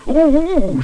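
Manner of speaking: high, excited "ooh"; gleeful high-pitched cry; excited shout. A narrator's reading. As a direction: whoop it back eagerly. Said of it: "shouted Paddington excitedly".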